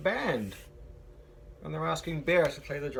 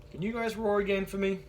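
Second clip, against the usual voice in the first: ordinary delivery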